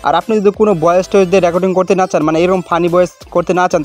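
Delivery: ordinary delivery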